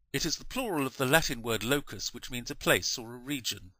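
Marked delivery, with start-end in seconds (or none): none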